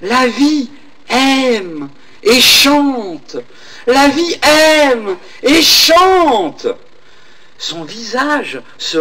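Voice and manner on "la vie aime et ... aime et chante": very slow, drawn-out faraway echo. Acted. The echo keeps answering the old woman, very slowly and distantly. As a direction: stretch each word like a voice across the valley. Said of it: "Et l'écho continuait".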